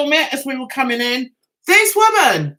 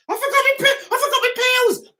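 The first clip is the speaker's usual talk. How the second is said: mock panic; 12 semitones higher than usual. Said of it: "He's like that".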